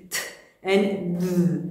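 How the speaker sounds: "th and th" as two drawn-out separate sounds; slow contrast of one "th" with the other "th"; isolated th sounds. Shown as a demonstration. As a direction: hold each "th" slowly for the listener to tell apart. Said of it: "टीएच थ sound निकालता है".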